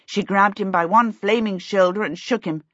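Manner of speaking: ordinary delivery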